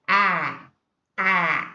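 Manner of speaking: "a" as in apple, held; vowel sound demo. Shown as a demonstration. Teacher isolating the sound from apple, drawn-out front vowel. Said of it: "that's the sound".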